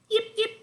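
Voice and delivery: high little puppy yips; quick, high-pitched yipping; imitated dog yips. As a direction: yap like a small dog.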